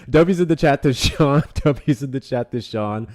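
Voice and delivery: giggling thanks to a donor; laughing. Real, not acted. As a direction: push the shout-out through laughter. Breaks up laughing during thanks.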